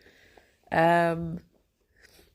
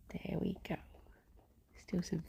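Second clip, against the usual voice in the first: whispering; 13 dB quieter than usual. Real, not acted. Whispering while brushing the bunny.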